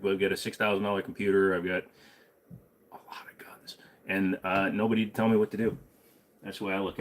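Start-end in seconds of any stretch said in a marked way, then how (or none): 2.9-3.8 a whispered punchline. Acted. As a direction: drop to a secret whisper. Punchline of his brag list.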